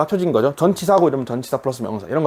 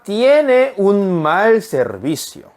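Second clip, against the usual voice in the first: slow model reading; 4 semitones higher, much slower than usual. Shown as a demonstration. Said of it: "같은 동사를".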